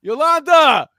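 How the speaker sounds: high, loud holler for the maid; calling out. Acted. Paul summons his maid mid-story, calling out. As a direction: shout the name through the house.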